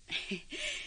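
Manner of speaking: short high delighted giggle; a little tittering laugh of relief; soft rising giggle; pleased laugh. Acted.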